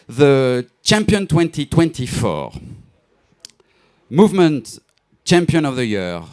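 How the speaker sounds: loud, high, rousing announcement; award announcer. Acted. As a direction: build it up like a gala host.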